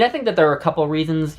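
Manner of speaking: ordinary talk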